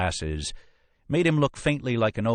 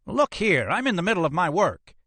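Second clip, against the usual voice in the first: very high-pitched indignant examiner; indignant protest; 13 semitones higher, 3 dB louder than usual. Acted. Interrupted medical examiner objects indignantly.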